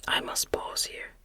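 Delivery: ordinary delivery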